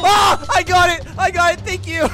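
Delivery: high-pitched relieved exclamation; breaking into delighted relief; excited relief. Real, not acted. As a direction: blurt it out on grabbing the prize. Grabs key under pressure.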